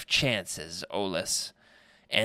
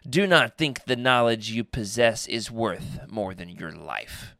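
usual speaking voice

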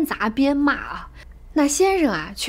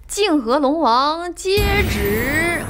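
slow, drawn-out, louder proclamation; imperial herald; 4 semitones higher, 4 dB louder, much slower than usual. Retold or imitated. Herald announcing the jade edict to the Jing River Dragon King, slow and loud. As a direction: stretch out each word like a heavenly herald reading a decree. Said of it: "我就随便学一下".

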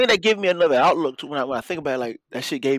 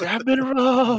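high, loud imitation of panic; hammed-up victim's cry; mock alarm; 10 semitones higher, 5 dB louder, much slower than usual. Imitates the robbed cry with jazz hands.

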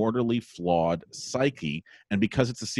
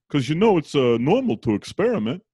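higher, louder Brooklyn father, awkwardly explaining; put-on Brooklyn accent, pitched up; Brooklyn detective dad; 6 semitones higher, 5 dB louder than usual. Brooklyn dad explains experimenting to son, high and awkward. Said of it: "he talks like a detective from Brooklyn".

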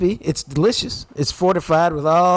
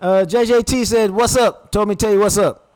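mock hearty greeting; 7 semitones higher, 5 dB louder than usual. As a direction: call it out louder and higher, as a pretend hello. Coaching listeners' greeting to the owners.

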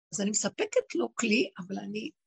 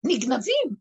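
ordinary delivery

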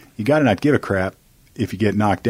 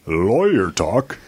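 radio voice; slower than usual. Pretend. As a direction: announce it like a late-night DJ. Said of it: "I gotta perfect my radio voice".

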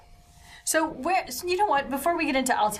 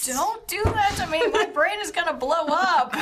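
exasperated laughing protest; 8 semitones higher than usual. Real, not acted. Overwhelmed player protests laughing.

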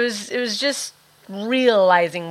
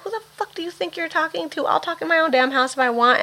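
higher, defiant retort to her father; talking back to dad; 9 semitones higher than usual. Retold or imitated. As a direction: put him in his place, pitched up. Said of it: "I'm like".

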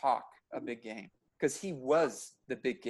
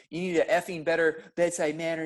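imitating angry patient; 3 semitones higher, 4 dB louder than usual. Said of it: "He's cursing at me like".

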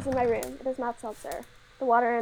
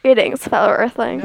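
loud mock alien greeting, mic clipping; clipping distorted mic; 2 semitones lower, 10 dB louder than usual. Real, not acted. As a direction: greet the Earthlings, blasting the mic. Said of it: "your volume's just up really high".

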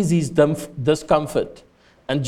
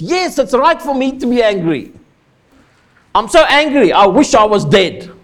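put-on higher, louder sulky tantrum as a stamping toddler; toddler tantrum Jonah; 6 semitones higher, 5 dB louder than usual. Jonah sulking at God like a toddler in a tantrum. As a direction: throw a childish fit at God. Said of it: "He's stamping his little feet".

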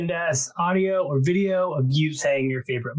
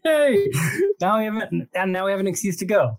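excited; 5 semitones higher, faster than usual. Real, not acted. Excited about visiting Australia.

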